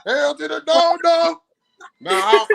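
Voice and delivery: high emphatic shout; high loud refusal. Real, not acted. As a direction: refuse it loudly, disgusted. Refusal continues.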